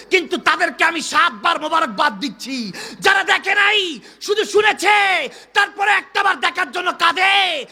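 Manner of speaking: loud, high-pitched impassioned sermon; fervent shouted preaching; full-force preaching. Acted. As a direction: cry it out to the whole congregation, rising to shouted peaks.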